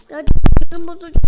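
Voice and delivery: loud, fast burst of frustration; angry fast outburst; frustrated yell. Real, not acted. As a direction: blurt it out fed up. Frustrated by game.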